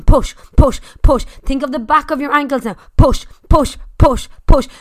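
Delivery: loud driving coach calls; shouted push. Real, not acted. Spin coach yelling riders through the climb. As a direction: bark each push to the beat.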